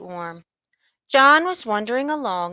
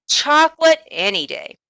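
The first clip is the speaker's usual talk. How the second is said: higher, louder, keen; eager boy; 8 semitones higher, 6 dB louder than usual. A narrator's reading. John choosing chocolate over coins, eager. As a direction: blurt it out eagerly.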